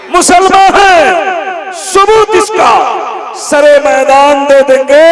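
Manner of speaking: loud, slow declaimed couplet building to a high shout; full-force stage poetry recital; impassioned verse recitation. Acted. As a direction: fire up the crowd with ringing, drawn-out verse.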